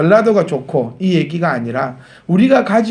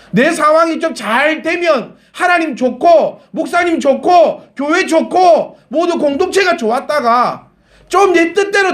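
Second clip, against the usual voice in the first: higher, building preacher's cry; fervent preaching; 9 semitones higher than usual. Acted. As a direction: preach it with rising fervor.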